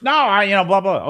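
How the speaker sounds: put-on high, loud whiny kid refusing; mock whiny child. Parent mimicking a daughter's whiny refusal, high and loud. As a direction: whine it like a sulking four-year-old. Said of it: "the answer is".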